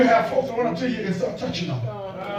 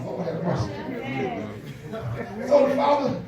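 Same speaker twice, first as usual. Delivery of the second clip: much softer, intimate sermon; hushed preaching; 6 dB quieter than usual. Acted. Pastor softly telling how the father ran to his son.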